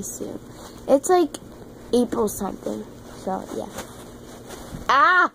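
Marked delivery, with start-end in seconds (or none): 4.9-5.3 sudden yelp while slipping; startled yelp. Real, not acted. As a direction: cry out as you nearly fall. Startled nearly falling off bed.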